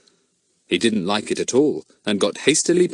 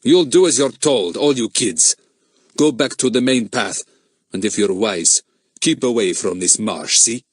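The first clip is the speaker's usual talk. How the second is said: put-on louder, menacing foreign accent; threatening the kids off the path; bullying foreign man; 3 dB louder than usual. A narrator's reading. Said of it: "I'm having no nonsense, he said".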